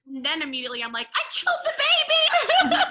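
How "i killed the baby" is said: panicked laughing shriek, much higher and louder; freaking out. Retold or imitated. Telling the host how she panicked at the dinner table, laughing high shriek. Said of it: "Freaking out".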